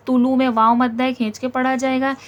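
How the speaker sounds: ordinary delivery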